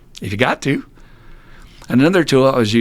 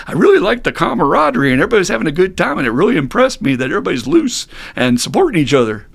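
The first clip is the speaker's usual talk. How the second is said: high, fast, gushing praise; enthusiastic newcomer; 7 semitones higher, 3 dB louder, faster than usual. Retold or imitated. Newcomer praising the home group's camaraderie, imitated enthusiasm. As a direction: imitate a newcomer's delighted rush of approval. Quotes delighted newcomer after complainers.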